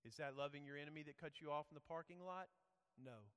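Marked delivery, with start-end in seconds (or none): none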